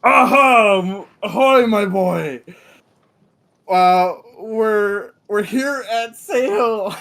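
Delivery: loud jolly ship captain; hearty captain voice. Acted. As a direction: greet the deckhand like a booming captain. GM voicing the captain.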